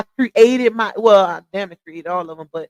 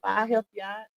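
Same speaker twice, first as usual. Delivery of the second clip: usual speaking voice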